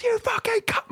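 high-pitched yell at no great volume; retold scream. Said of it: "mate, I was screaming".

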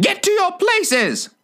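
very high, screeched royal order; high, commanding queen's screech; thunderous shout. A narrator's reading. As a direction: shriek the command, stretching the words. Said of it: "shouted the Queen in a voice of thunder".

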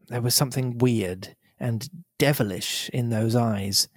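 plain reading voice

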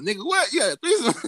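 high, loud incredulous demand; hot, mock-outraged. Man wakes his friend to confront him, hot. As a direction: confront him loudly, voice climbing toward laughter. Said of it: "So I'm hot, wake him up".

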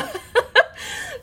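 high-pitched bubbling giggle; real laugh. Real, not acted. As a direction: break into a high laugh mid-thought. Laughs at the irony.

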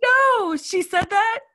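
very high, loud disbelief; astonished. Real, not acted. Cohost reacting to an outrageous movie line, astonished. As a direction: squeal it in delighted disbelief.

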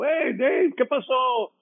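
high cheerful hello called back; retold friendly greeting. Johnny calling back a high cheerful greeting to Dave.

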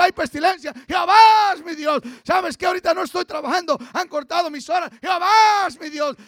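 loud, rising preacher's cry of faith; full-force preaching. Acted. Pastor rousing the church against hardship, full-force preaching. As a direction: preach it out to the congregation, building.

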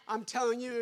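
ordinary delivery

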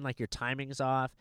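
usual speaking voice

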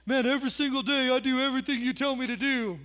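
lightly voiced complaining child; a touch of a whining kid; mock kid protesting his innocence; whiny kid. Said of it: "Have your kids ever done that?".

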